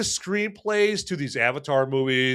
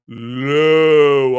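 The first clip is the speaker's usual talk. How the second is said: "low" held long and much louder; stress on low; 9 dB louder than usual. Real, not acted. Host urging viewers to lower expectations, loud stretched "low". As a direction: lean hard on "low". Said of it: "I'm talking about very low".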